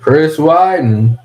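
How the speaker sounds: loud, slow, drawn-out ring announcer call. Real, not acted. Streamer announcing a fighter's name, loud slow ring call. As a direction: boom the name like introducing a fighter.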